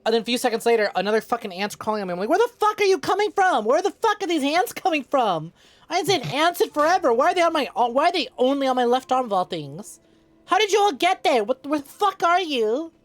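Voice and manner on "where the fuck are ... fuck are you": high, loud frantic shouting; panicked yelling. Retold or imitated. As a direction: yell it in freaked-out panic. Retelling panic over ants on arm.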